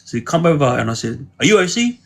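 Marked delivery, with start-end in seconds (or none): 1.4-2.0 confronting question. Retold or imitated. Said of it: "Like that".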